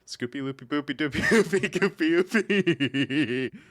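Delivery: high giggling read of the name; laughing. Real, not acted. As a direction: laugh your way through it. Giggling through silly name.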